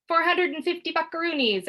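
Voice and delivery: high gleeful demand; gloating. Acted. Boy demanding rent from sister, high and gleeful. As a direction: crow it like a tycoon.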